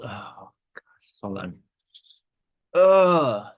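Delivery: real tears break through, voice drops almost away, then a strained cry; choking up. Real, not acted. Dad holding newborn Aria breaks down, real crying. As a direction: lose the words to tears and pull back. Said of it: "I'm trying not to get too emotional".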